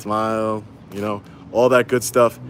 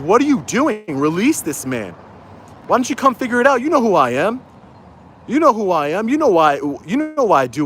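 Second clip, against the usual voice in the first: indignant confrontation; 7 semitones higher than usual. Real, not acted. Still confronting the chief.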